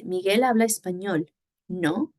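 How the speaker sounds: ESPAÑOL falls, then NO rises; tag-question intonation. Shown as a demonstration. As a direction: state it, then lift just the tag "no". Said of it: "intonation on just".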